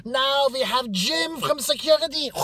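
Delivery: very high, loud summons; caricatured shout calling up the next speaker; strict orientation leader. Retold or imitated. Woman running orientation calls up Claudia, barking high.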